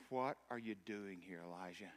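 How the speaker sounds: God's soft question to the hiding prophet, gently hushed; gentle whisper. Retold or imitated. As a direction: ask it softly, like the still small voice. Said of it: "gentle whisper".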